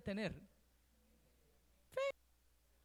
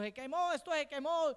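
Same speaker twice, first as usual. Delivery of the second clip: raised, louder alarmed shout of a parent; mock panicked parent; alarmed cry; 5 semitones higher, 4 dB louder than usual. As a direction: cry out that it's burning. Parent discovers something burning.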